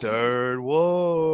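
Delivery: drawn-out hype call; announcer call. Acted. After listening, nailing the announcer's drawn-out call. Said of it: "There you go".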